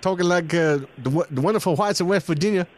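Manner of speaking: high hammed-up Appalachian voice; West Virginia accent. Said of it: "I'll try and do the accent".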